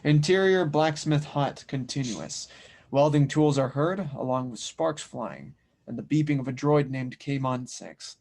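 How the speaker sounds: steady audio-drama narrator reading stage directions; calm narrator voice describing the scene; scene narration. A narrator's reading. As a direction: set the village scene for the listener.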